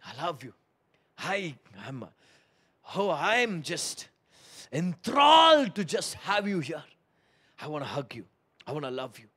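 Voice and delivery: hushed loving embrace in words; tender Holy Spirit voice. Pretend. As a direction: whisper love like a hug. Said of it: "gives them a bear hug and says".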